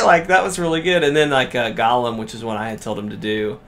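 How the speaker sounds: ordinary talk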